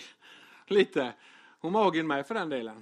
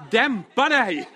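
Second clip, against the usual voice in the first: louder and higher, half laughing; laughing mock command; 5 semitones higher, 6 dB louder, slower than usual. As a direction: snap it at the phone through laughter. Tells the ringing phone off.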